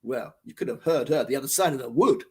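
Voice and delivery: ordinary delivery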